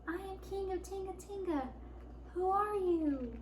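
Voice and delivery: high, soft, timid little voice; tiny voice. A narrator's reading. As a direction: squeak it out small and unsure. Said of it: "said Lion in a tiny voice".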